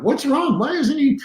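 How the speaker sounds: high, baffled "what's wrong?"; confused freaking out. Retold or imitated. Rattled opponent wondering why he stays calm, high and baffled.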